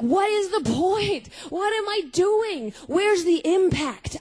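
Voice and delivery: high, slow, searching questions; drawn-out despairing cries of a restless teen; anguished questioning. Retold or imitated. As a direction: plead with the air for meaning. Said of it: "And I'm like".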